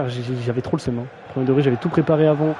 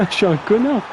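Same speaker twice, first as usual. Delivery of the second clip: higher, mock self-scolding; 6 semitones higher than usual. Streamer teasing himself after botching a name, mock high voice. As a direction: call yourself an idiot in a silly voice. Repeats the self-mockery, laughing.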